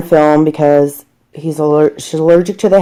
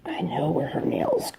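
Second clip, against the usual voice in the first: unvoiced whisper while concentrating; 14 dB quieter than usual. Real, not acted. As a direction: whisper it, focused on the nails. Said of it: "why are you whispering".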